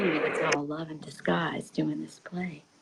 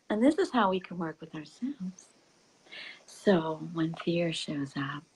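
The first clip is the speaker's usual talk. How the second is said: ordinary delivery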